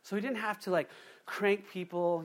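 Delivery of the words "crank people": ordinary talk, nothing marked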